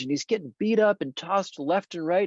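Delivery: normal talking voice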